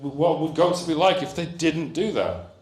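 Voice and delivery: usual speaking voice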